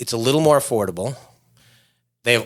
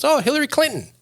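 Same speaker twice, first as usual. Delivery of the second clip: put-on much higher "Oh, Tom Hanks" in a Thai cabbie accent; Thai cab driver accent. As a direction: chirp the name like the Thai driver. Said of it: "And he goes".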